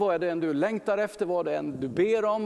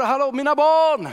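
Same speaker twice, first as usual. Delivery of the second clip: joyful shout across the water, louder and higher; calling out; 7 semitones higher, 9 dB louder than usual. Acted. Jesus calling to his disciples on the lake, loud joyful call. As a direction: call out to them a hundred meters off. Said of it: "så bara ropar han ut".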